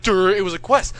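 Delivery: loud, high "duh" taunt; mocking dopey. [name] mocks the quest excuse, loud dopey taunt. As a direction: taunt him like he's an idiot.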